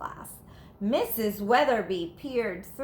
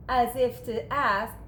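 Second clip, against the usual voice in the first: plain reading voice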